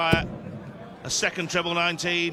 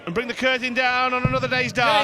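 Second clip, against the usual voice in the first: high, loud climactic call; excited commentary; 7 semitones higher, 5 dB louder than usual. Real, not acted. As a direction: build to a big finish.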